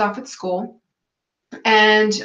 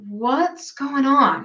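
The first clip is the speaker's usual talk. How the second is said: husband's slow concerned question; 3 semitones higher, much slower than usual. Retold or imitated. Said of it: "He's like".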